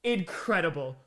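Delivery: ordinary talk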